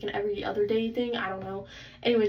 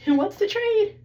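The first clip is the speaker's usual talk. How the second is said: high, loud call to everyone; 11 semitones higher, 7 dB louder than usual. Acted. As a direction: shout the offer like an auctioneer. Calling out to the audience.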